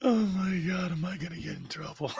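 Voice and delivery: usual speaking voice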